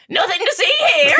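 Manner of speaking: witch voice. Acted. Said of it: "You're a guard, not a witch".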